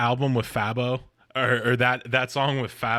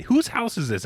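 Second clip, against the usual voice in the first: much higher retold question; mock bafflement; 10 semitones higher than usual. Guest wondering about Benny in pajamas, mock bafflement. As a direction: play a puzzled party guest.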